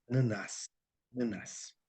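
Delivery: soft Portuguese "Ananás"; Portuguese pronunciation. Shown as a demonstration. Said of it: "En portugués sí, suena muy bonito". (pronunciación portuguesa suave)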